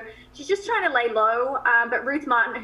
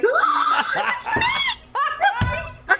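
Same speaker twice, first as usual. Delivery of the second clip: much higher, laughing delighted squeal; excited squeal; much slower than usual. Retold or imitated. Actress retelling her delight at getting the role, squealing with laughter. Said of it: "I was like".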